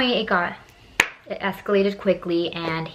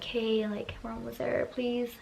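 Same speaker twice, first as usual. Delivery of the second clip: soft, higher, pleading voice; timid plea; 4 semitones higher, 8 dB quieter than usual. Retold or imitated. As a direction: ask meekly, barely daring. Retold timid plea to driver.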